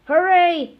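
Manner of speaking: high, loud cheering hooray; excited cheer. A narrator's reading. Kids cheering at the news, loud and high. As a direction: cheer like a class of delighted kids. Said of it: "cried the children".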